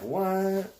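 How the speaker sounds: high-pitched, drawn-out what; mock disbelief. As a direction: act out a baffled reaction. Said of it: "this is where I'm like".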